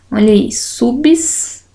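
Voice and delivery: usual speaking voice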